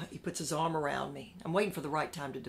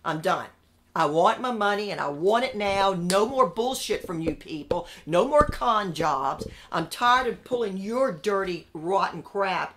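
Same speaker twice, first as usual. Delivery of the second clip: louder, forceful furious demand; angry demand; 8 dB louder than usual. Retold or imitated. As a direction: hammer the demand home, fed up. Furious demand for his money.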